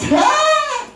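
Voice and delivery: a high, sudden yelp of shock; the demon jumps in fright; preacher acting out the startled demon with a shrieking cry. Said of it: "The demon will be shocked".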